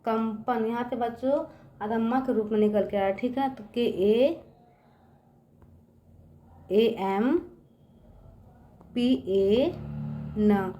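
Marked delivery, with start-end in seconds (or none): 3.7-10.7 पी ए एन said very slowly, letter by letter; slow letter spelling. Shown as a demonstration. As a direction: spell पी ए एन out for kids to write. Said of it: "teacher spells letters for dictation". (धीरे-धीरे अक्षर बोलकर लिखवाना)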